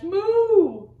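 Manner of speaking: loud, high, drawn-out moo; mooing cow. Acted. Cow splashing in the pond, big drawn-out moo. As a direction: let the moo swell up and out. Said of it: "Cow says".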